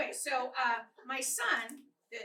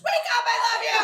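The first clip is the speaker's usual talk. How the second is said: suddenly shouted very loud and much higher; frantic yell; 10 semitones higher, 17 dB louder, faster than usual. Acted. Wife yelling to wake the husband paying for college, frantic yell. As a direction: scream it to rouse someone asleep.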